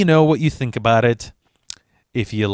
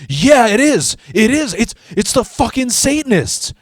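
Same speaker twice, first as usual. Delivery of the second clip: panicky friend; 10 semitones higher than usual. Retold or imitated. Stoned friend blurting out his Satanist theory, high and frantic. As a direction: play the spooked buddy, pitched way up.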